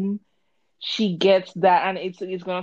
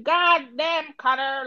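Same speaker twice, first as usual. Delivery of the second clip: higher-pitched mock put-on way of talking; imitated put-on voice; 7 semitones higher, slower than usual. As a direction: demonstrate an out-of-place affected voice, pitched up. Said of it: "Why the fuck are you talking that way".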